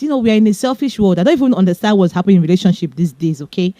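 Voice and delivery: rattled off in a quick rush; rapid, run-on chatter; talking too fast. Real, not acted. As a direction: speed through it without pausing. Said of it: "I'm talking too fast".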